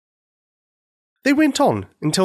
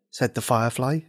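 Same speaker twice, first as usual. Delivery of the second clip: ordinary delivery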